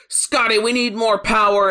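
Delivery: a hint of Captain Kirk calling for power. Captain calling his engineer, mock Kirk. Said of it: "Kirk is like".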